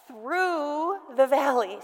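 drawn-out "Through", slow; "Through" held long, then "the valley"; stress on THROUGH. Shown as a demonstration. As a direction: stretch "Through" long as the key word. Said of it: "we're gonna emphasize this".

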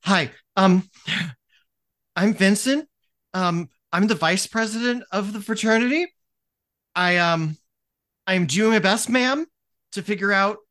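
a timid vice president, stammering and unsure; meek nervous student. Acted. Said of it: "They're kind of meek a little bit".

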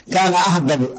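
ordinary talk

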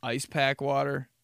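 normal talking voice

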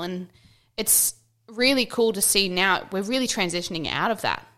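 ordinary delivery

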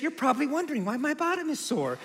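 high, soft mom impression; sweet mom voice. Mom starting her story at lunch, sweet high voice. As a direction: coyly imitate a mother, high and hushed. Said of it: "And then she says".

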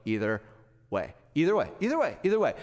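"either way" repeated, trailing off lightly; lightly voiced shrugging buddy; friend's catchphrase. Pastor mimicking his hunting partner, mild shrug. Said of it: "he always says".

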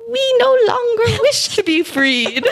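high, slow, eerie voice breaking into a giggle; creepy test subject. Retold or imitated. Telling a horror story to her cohost, the test subjects' eerie reply, high and slow. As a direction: draw it out high and spooky like a horror-movie ghost. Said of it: "That's how they sound now".

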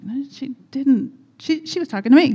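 high, indignant no-she-didn't; indignant disbelief. Retold or imitated. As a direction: play the outraged realisation. Wife reacts indignantly in store.

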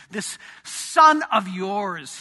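slow, scornful "This son of yours"; elder son disowning his brother; contemptuous. Retold or imitated. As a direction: spit it out slowly with disdain. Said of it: "Can you hear the contempt on his voice".

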